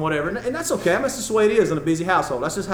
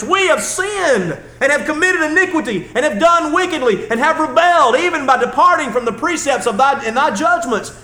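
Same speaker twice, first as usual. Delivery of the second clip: loud, high, pleading prayer at full force; preaching a prayer with full force; fervent prayer; 6 semitones higher, 6 dB louder than usual. Acted. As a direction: cry out a confession to God. Preacher voicing Daniel's prayer.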